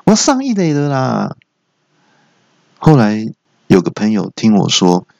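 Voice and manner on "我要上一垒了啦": mock outraged cry held up high; acted shout. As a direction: holler a complaint at a bad pitcher. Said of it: "那我就大喊说".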